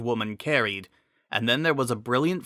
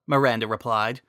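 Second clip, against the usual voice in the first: usual speaking voice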